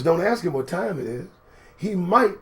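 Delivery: normal talking voice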